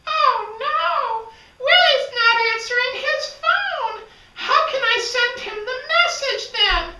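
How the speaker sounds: high, loud fretting character; worried high voice. Acted. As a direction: panic about the unanswered call. Francesca panics, phone unanswered.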